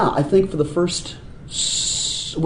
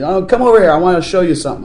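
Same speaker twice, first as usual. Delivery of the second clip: higher, louder beckoning; imitated dad calling over; 5 semitones higher, 5 dB louder, faster than usual. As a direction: call a child over, as a retold parent. Voicing dad calling child over.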